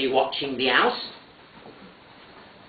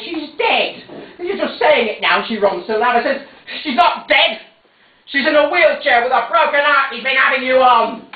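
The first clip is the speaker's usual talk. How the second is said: heated argument; 9 semitones higher, 4 dB louder than usual. Acted. Heated quarrel with mother.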